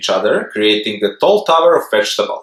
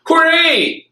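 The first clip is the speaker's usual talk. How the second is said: cheering. Acted. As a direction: shout hooray like help just arrived. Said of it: "they cheered".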